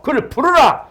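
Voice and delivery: loud, very high-pitched summons; commanding call. Acted. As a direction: call it out like an order across a crowd. Acted command of Jesus to summon. (크고 높게 외치는 명령)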